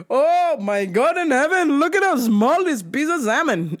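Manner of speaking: high, swooping mock dismay; hammed-up complaining dad voice; appalled dad. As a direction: imitate dad's theatrical horror. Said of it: "he goes".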